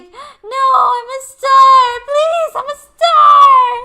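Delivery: high, slow, wailing desperate plea; Pearl impression. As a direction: beg through tears like Pearl. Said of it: "that part where she's like".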